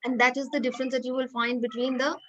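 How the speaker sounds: ordinary talk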